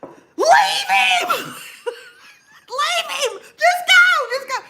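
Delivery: very high, tearful shriek breaking into laughter; hammed-up hysterical scream to abandon him; panicked yell. Passenger telling the driver to abandon her friend, yelling. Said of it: "And I yell".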